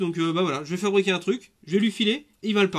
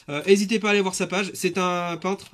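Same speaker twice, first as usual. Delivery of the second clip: usual speaking voice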